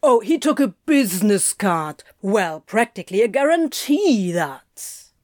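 mocking echo, stretched with exaggerated swings; eye-rolling sarcasm. A narrator's reading. Crowley mocking his coworker's business card boast, sarcastic. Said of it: "Crowley rolled his eyes".

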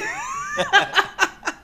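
squealing high laugh. Real, not acted. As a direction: laugh up in falsetto. Laughing at the scene.

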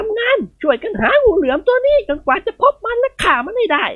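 put-on high, commanding old lady's voice; a matron rallying every servant to hunt the snake; bossy old lady. Khun Ying Wad commands the household.

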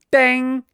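high, loud bell-like ding; ping it out like the bus stop button; imitated bus bell ding. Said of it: "it goes".

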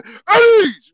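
sudden high shouted command; barked command. Retold or imitated. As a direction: bark the drill order out hard. Barking command.

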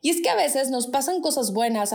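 ordinary talk, nothing marked

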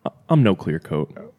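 usual speaking voice

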